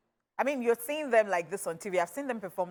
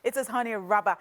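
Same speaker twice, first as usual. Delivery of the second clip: normal talking voice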